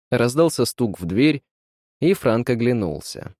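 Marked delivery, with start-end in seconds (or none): none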